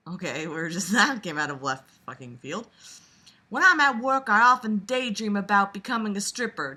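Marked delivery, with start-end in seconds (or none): 3.5-6.7 southern belle. Acted. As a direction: read it as a dainty Southern belle. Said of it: "I'll do this reading all in Southern Belle voice".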